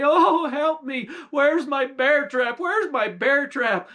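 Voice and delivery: the witch shrieking for her bear trap as the bear lunges; cringing in fear. Acted. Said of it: "she cringed to say".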